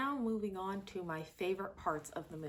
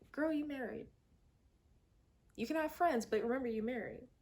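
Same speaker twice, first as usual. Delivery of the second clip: higher, teasing sassy scold; sassy mock warning; 6 semitones higher, faster than usual. Reviewer talking to Pearl on screen, a sassy higher warning. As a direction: wag a finger at her playfully. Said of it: "I was sitting there going".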